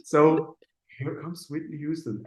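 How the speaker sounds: usual speaking voice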